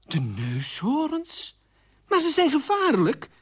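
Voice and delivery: drawn-out disbelief, then high outcry; alarmed outcry. Acted. Queen hears of freed rhinos, aghast and crying out. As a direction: repeat it incredulously, then cry out loudly. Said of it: "riep de koningin".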